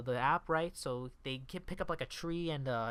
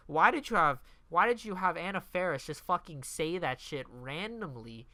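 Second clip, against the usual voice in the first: ordinary talk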